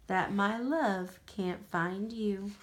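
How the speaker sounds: ordinary delivery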